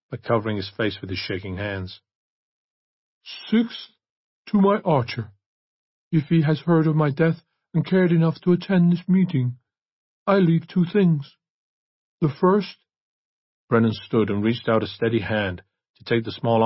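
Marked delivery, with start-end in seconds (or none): 3.5-12.8 raised, measured voice of a priest at a reading; priest reading a will. A narrator's reading. Priest reads Chrysalis's will to the church, solemn proclamation.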